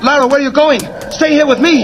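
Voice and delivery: high, urgent plea to stay. Acted. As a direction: beg her to stay, voice lifted high. Pleading as she leaves.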